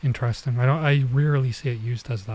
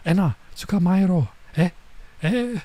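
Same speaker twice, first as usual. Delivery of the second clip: raised, high call to catch her; formal command; 6 semitones higher than usual. Retold or imitated. Girl ordering Enna to catch her, high call. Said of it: "commanding her in a formal way".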